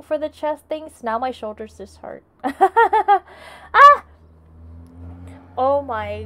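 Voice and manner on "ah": high squealing laugh. Real, not acted. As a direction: burst into a shrieking laugh. Sudden outburst on stream.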